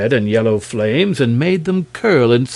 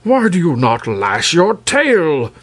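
higher, demanding royal voice; demanding king; 6 semitones higher, 4 dB louder than usual. Acted. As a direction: press the question as a king. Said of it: "King Terabus".

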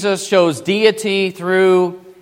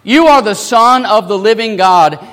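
high, loud confession of faith; outspoken declaration; 4 semitones higher, 7 dB louder than usual. Acted. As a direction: proclaim it boldly as Peter. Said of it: "Peter, being outspoken, says".

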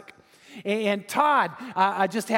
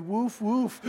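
tag. Retold or imitated. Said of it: "The one that barks the loudest".

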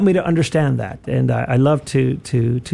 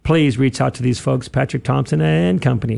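ordinary talk

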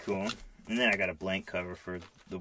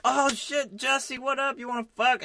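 high, hammed-up bro voice for the Flash sidekick; squeaky mock hero hitting on a girl; mock Wally; 3 dB louder than usual. Friend retelling a Flash scene, mocking Wally in a high squeaky bro voice. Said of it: "Wally's all like".

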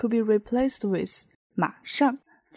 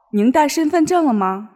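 higher, louder clerk asking politely; hotel clerk; 5 semitones higher, 6 dB louder than usual. Acted. Hotel clerk asking the guest for ID, brisk and bright. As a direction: sound like a brisk front desk clerk.

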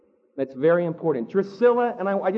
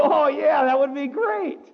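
eager yes; 5 semitones higher than usual. Retold or imitated. Gullibly accepting flatterer's sales offer.

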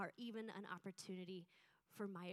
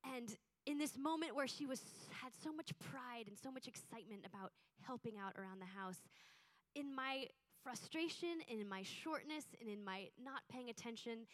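normal talking voice

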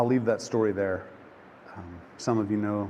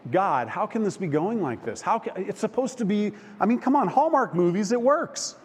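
high-pitched exasperated plea to God; comically indignant protest; mock freaking out; 7 semitones higher than usual. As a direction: retell a frustrated prayer, pitch climbing. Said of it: "freaking out in this moment".

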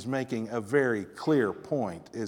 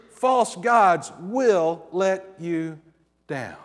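slow emphatic warning; 4 semitones higher, 5 dB louder, much slower than usual. Shown as a demonstration.

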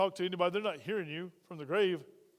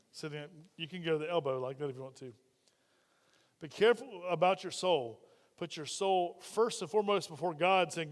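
normal talking voice

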